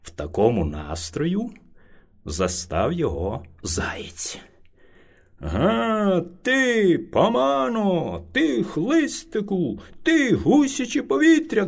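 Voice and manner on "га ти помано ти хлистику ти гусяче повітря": high, angry bear yelling at the hare; bear shouting. A narrator's reading. Bear yells at the late hare, angry. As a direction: bark the insults at the little hare. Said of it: "кричав на нього ведмідь".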